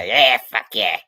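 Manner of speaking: hearty Scottish shout breaking into a laugh; loud Scottish father-in-law. Retold or imitated. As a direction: bellow it like a big loud Scotsman offering coffee. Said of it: "He yelled at you".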